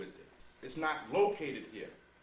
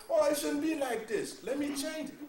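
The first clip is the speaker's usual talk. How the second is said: mock shocked person; 14 semitones higher, 8 dB louder than usual. Mocking shocked people.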